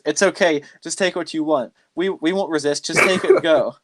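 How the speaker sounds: high, pleading give-up voice; hands-up surrender, pleading; mock surrender. Woman blinded by light, giving up at once in a pleading voice. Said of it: "she just gave up immediately".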